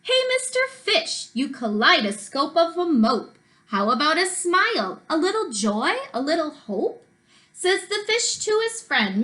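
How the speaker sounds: rhythmic picture-book storytelling with swooping pitch; sing-song storybook narration; reading to children. A narrator's reading. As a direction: read the rhyme aloud to young children, bouncing on the stresses.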